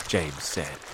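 ordinary delivery

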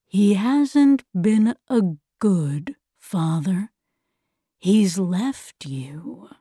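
slow, drawn-out villain's taunt; insinuating villain. Acted. The voice turning Meg against her father, slow insinuating villain.

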